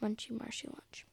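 soft hushed whisper. Real, not acted. Girl repeating the moose's lunch in a soft whisper. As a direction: whisper the phrase again, softly.

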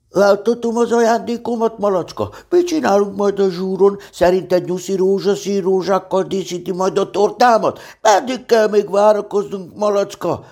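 high, loud, eager run of questions; breathless gabble. A narrator's reading. Pooh to Piglet about present and party, breathless gabble. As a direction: reel off the questions in one breath, pitched high. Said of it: "hadarta el egy szuszra kérdéseit".

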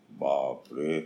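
ordinary delivery

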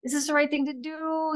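high, soft, drawn-out wondering; retold doubting. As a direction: imitate second-guessing yourself, hushed and slow. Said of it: "every time we started doubting".

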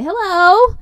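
high, loud call to a shy friend. Real, not acted. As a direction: coax her to say hi out loud. Calling producer to speak.

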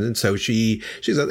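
usual speaking voice

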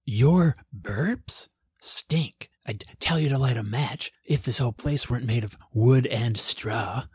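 confidential whispered scolding. A narrator's reading. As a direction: lean in and whisper the complaint. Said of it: "leaned into George and whispered".

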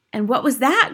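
high, eager crowd question; cried. A narrator's reading. Witch audience asking about the invention, eager cry. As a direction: ask breathlessly keen, in a high voice. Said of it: "cried the audience".

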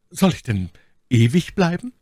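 high question with a touch of fright; some alarm. A narrator's reading. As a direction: ask it in restrained, acted alarm. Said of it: "mit einem gewissen Schrecken". (leicht erschrockene, hohe Frage)

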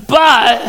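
"but" thrown out high and loud; emphatic but. Acted. Preacher excited by God's work, then a loud, high "but". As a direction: punch "but" and leave it hanging.

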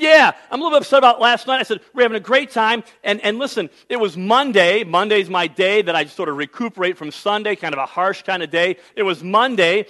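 loud, worked-up self-defense, replaying the argument; hammed-up huffy husband listing his excuses; retold indignant complaint. Preacher replays defending himself to his wife, mock indignant.

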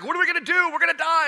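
high, panicky cry of a terrified servant; panicked servant. Acted. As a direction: squeal it out, sure they're about to die. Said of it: "the servant utterly panics".

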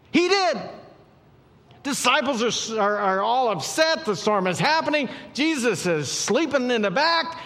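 high, loud, driving storm story; full-force preaching. Real, not acted. Preacher telling of Jesus calming the storm, full-force and high.

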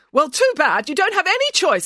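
high, forceful overruling; bossy retort. A narrator's reading. Rival nanny overruling a refusal, bossy. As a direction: shut down the objection.

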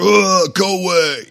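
put-on high, loud groaning kid voice; grumpy kid. Son groans at dad waking him, grumpy high whine. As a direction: whine it like a grouchy boy shoved awake.

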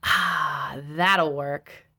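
low, satisfied "ah" after a swig; settled, low-key approval; decisive, satisfied. A narrator's reading. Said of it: "finished swallowing with a decisive".

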